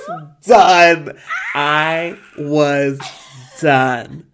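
loud, dramatic declaration; emphatic outburst. Retold or imitated. Retelling a breakdown on the phone to parents, loud and dramatic. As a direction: belt each word out with pauses between.

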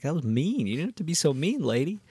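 high, swooping complaint; mock hurt protest. Acting out protest at a mean lady.

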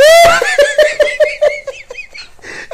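loud squealing laugh; loud high laugh. Real, not acted. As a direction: let out a big high laugh. Big laugh at friend.

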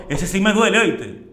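father's warning. Retold or imitated. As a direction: say it hurts, pressing the point. Father voiced about cash. (padre advirtiendo con fuerza, voz alta)

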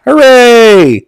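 high, drawn-out sarcastic hooray; mock cheer. Reviewer reacting to a man burning, mock cheer. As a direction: cheer with hollow, put-on glee.

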